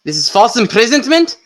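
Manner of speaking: put-on indignant prisoner shouting in a wobbly Scottish accent; attempted Scottish accent. Angry inmate yelling at guards, failing Scottish accent. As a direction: demand release from the guards in a shaky Scottish brogue. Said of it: "does your guy have a German accent".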